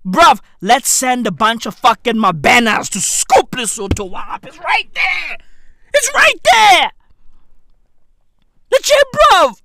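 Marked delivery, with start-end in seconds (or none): 4.4-9.6 hyped shout. Acted. Urging a takeover of Lesotho.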